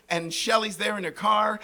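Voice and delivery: usual speaking voice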